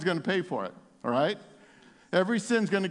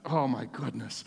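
usual speaking voice

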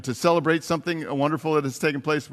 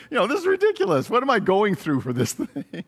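high, fast, incredulous outburst; mock-exasperated squeal of this is ridiculous; 6 semitones higher than usual. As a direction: throw up your hands in disbelief. Exasperated with praying.

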